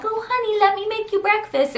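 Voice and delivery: high, sweet-talk mimicry; mock fussing over a husband; doting wife. Wife mimics offering her husband breakfast, doting high voice. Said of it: "it wasn't like".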